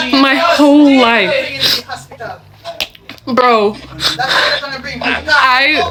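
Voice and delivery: slow, tearful, voice breaking; crying. Real, not acted. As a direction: let the tears slow the words down. Venting about family, breaks down.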